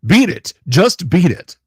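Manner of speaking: loud, high, punchy Shorts narrator; Delver Shorts voice. Shown as a demonstration. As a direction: shout the lyric like a short-video host. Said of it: "you want Delver Short voice".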